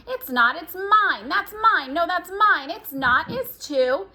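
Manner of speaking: whiny sibling demanding her sock; squabbling child. Acted. Twins quarreling over clothes.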